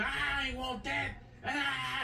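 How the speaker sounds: high, slow, drawn-out mock voice; mocking quote. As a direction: sneer it as a dismissive voter.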